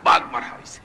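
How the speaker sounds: voiceless, dropped answer; low whispered echo; hushed repeat. Real, not acted. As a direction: drop from shouting to an unvoiced murmur. Low repeat after shouted version.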